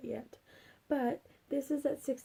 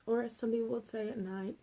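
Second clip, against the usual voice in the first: ordinary talk, nothing marked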